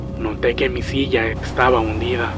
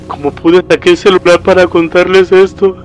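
raised, higher voice near breaking; desperate, tearful; 5 semitones higher, 8 dB louder than usual. Acted. Father explaining his desperate call to family, near tears. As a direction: push the words out through panic.

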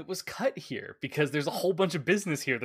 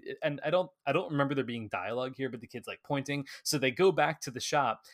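ordinary delivery

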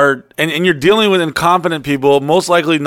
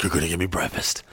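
lower, much softer threatening voice; menacing low movie guy; 9 semitones lower, 11 dB quieter, faster than usual. Retold or imitated. Retelling the movie man demanding breakfast, low and soft. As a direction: growl it low like the Falling Down guy. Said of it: "And he's like".

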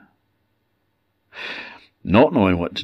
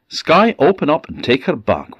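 lightly raised command to the sky; commanding fart; 7 semitones higher than usual. Acted. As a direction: order it grandly.

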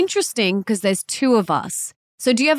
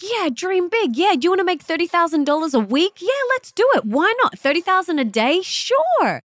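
much higher, bubbly hammed-up yes-to-everything cheer; gushing American enthusiasm; 10 semitones higher than usual. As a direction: play the wildly enthusiastic American friend, squealing high. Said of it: "They're like".

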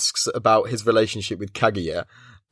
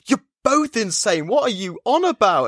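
high-pitched mock exasperation; retold meltdown; 10 semitones higher than usual. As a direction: act out an incredulous outburst, pitch way up. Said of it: "she's just melting down outside".

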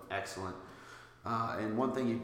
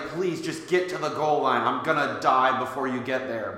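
louder, higher, pleading yell; excited yelling; 4 semitones higher, 9 dB louder than usual. Retold or imitated. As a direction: urge him on like a fan in the stands. Retelling yelling at slow returner.

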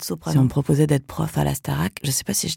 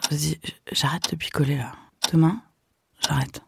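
chuchoté; 3 semitones lower, 4 dB quieter than usual. Pretend. Voix intérieure qui chuchote.

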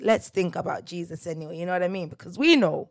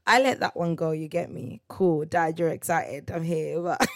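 ordinary delivery

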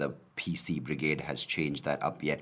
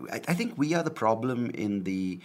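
ordinary talk, nothing marked

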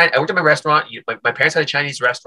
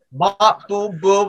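lightly voiced market demonstrator, pitched up; mop seller's patter; 7 semitones higher, much slower than usual. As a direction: mimic a punchy product demo.

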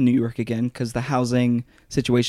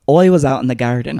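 louder, hammed-up stage Irish accent; bad Irish accent; 5 dB louder than usual. Mocking the video actor's lesson, bad Irish accent. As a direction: mimic a fake Irish accent from a tutorial. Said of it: "How to do an Irish accent".

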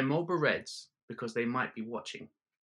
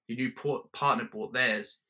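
usual speaking voice

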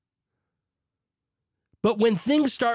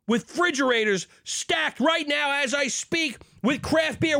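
high, fervent shouting; rally the crowd at full pitch; overzealous preacher yell; zealous shout; 11 semitones higher than usual. Acted. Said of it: "I got a little heated there".

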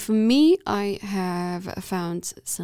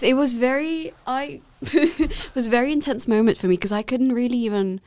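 ordinary talk

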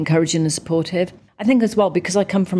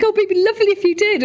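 much higher, fast, wheedling mock plea; wheedling constituent; faster than usual. Constituent pleading with the peer to fix his road, high and wheedling. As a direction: coax her sweetly into fixing the road.

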